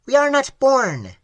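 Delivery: high, clicking alien voice; clicking creature. A narrator's reading. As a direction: click out the words like a strange creature. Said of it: "he clicked".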